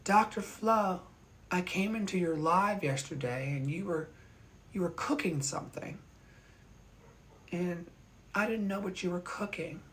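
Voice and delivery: light, high retelling of her hesitant words; mimicking her again. Retelling the woman's visit to viewers, lightly imitated. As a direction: lightly replay her awkward comment. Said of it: "That's how she said it".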